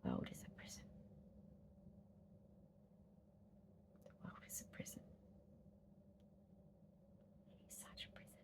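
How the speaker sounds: hushed repeated whisper trailing into nothing. Real, not acted. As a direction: breathe the words out, fading away. Repeated dark whispered refrain.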